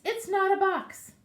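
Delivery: high, loud excited rabbit voice; a little rabbit crying out proudly; insistent rabbit. Acted. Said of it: "And the rabbit says".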